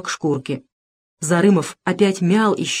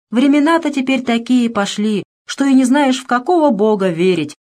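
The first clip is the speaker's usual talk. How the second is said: higher, half-joking squeak; half-joking squeaky voice; 6 semitones higher than usual. A narrator's reading. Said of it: "полушутя продолжал писклявым голосом".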